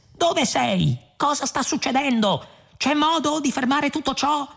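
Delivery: high, urgent questioning; calling out. A narrator's reading. Ford calling to the unseen voice for answers, urgent. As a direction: fire questions out at high pitch.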